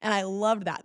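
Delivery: ordinary delivery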